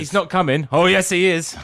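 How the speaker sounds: loud panto call and answer; panto crowd callback. Pretend. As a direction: play both sides of a panto shout.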